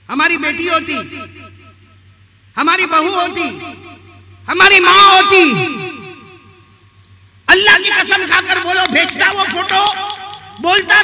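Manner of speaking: high, loud sermon building to shouts; full-force preaching. Real, not acted. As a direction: confront the congregation at full volume. (बुलंद आवाज़ में झकझोरती तक़रीर)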